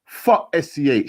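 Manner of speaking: slow, loud, high shouted insult. A narrator's reading. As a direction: bark it across the yard, slowly. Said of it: "shouted another voice".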